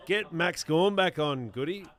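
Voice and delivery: loud yell at the TV; shouting. Real, not acted. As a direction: call out to the player. Yelling at players on screen.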